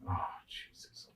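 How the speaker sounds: soft unvoiced whisper of dismay. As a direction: breathe it out in disbelief. Cop finds the shotgun.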